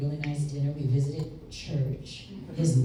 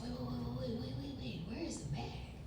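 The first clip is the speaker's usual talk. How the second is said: put-on anxious old man; 9 semitones higher, 15 dB quieter, faster than usual. Grandfather panicking about the bag.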